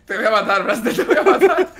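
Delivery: amenaza en broma que sube a agudo; amenaza entre risas. Real, not acted. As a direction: amenaza mientras te rompes de risa. Amenaza riendo al compañero. (amenaza aguda y risueña)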